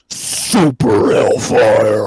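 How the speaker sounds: loud, very slow deep reveal; announcer reveal. Acted. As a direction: draw out the product name. Ad product name reveal.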